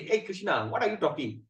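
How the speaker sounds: a hint of a cousin ribbing a friend; casual friend. Arjuna imagined talking to his cousin Krishna, mild casual teasing. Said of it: "He could have just said".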